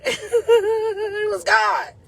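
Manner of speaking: "It was God" stretched out, slow and high, half laughing, half crying; laughing through tears. Real, not acted. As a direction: let "God" break into a tearful laugh of real joy. Tears of joy while praising God.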